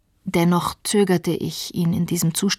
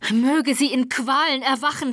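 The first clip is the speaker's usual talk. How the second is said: a high, wild cry of rage, pitched way up; furious cry; 6 semitones higher than usual. Acted. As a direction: hurl the curse back at her. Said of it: "schrie er mit erschreckender Heftigkeit".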